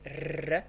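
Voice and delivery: R as a rolled tongue trill; São Paulo R, tongue vibrating. Shown as a demonstration. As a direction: trill a long "rrr" for R. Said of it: "with a vibrating sound".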